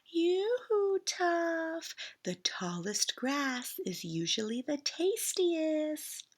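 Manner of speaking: high sing-song call across the meadow; high "yoo-hoo" call to a distant goat. Acted. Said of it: "he called loudly after".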